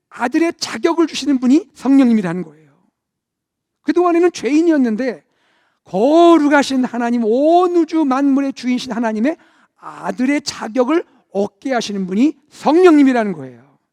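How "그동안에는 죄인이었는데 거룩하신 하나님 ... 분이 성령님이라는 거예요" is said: loud, rising sermon climax; preacher swelling with conviction; fervent preaching. Acted. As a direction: proclaim it to the whole church. Preaching at full force.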